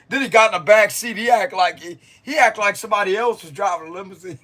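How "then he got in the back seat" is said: ordinary talk, nothing marked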